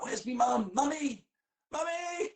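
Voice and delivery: put-on very high, loud childish wail; mock child crying for mum. Me in the cop shop calling for mum, high and childish. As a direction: wail for mummy like a lost kid.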